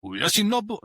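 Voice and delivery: high, hammed-up French try at Yoshinobu; mock French accent. Friend dares him to say the name in French, hammy French accent. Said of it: "Say his name in a French accent".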